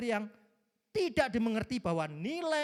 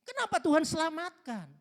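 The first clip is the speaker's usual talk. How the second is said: higher, louder complaint to God; angry complaint; 9 semitones higher, 4 dB louder than usual. Retold or imitated. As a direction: voice Jonah protesting angrily to God. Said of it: "Marah dia".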